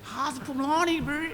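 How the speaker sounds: high, slow mocking voice; teasing kid. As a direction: mimic kids teasing him. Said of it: "I used to tease horribly".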